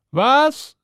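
high, affronted exclamation; indignant pancake. A narrator's reading. Pancake to the hungry cow, high indignant. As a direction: squawk it in disbelief, high. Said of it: "sagte da der Pfannkuchen".

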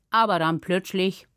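plain reading voice

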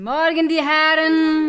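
cheerful wake-up greeting. Real, not acted. Carer waking the old men in their room, loud cheerful greeting. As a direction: sing out a good morning to wake the gentlemen.

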